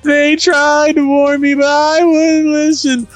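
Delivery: hammed-up bawling. A narrator's reading. Selphie clings to Quistis in tears, hammed-up falsetto bawl. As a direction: wail it all in mock-girl falsetto. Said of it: "she bawled".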